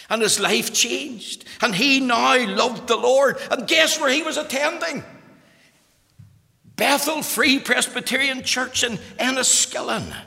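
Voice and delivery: loud, high-pitched pulpit delivery; preaching at full force. Acted. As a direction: ring it out to the whole congregation.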